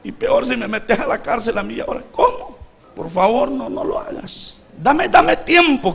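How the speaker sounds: high, breaking plea that drops to a whisper and back; a ruined servant begging his king for time; desperate debtor pleading. Acted. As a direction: beg the king not to take your wife and children. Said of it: "empiece a ver a este hombre, hermano, diciéndole".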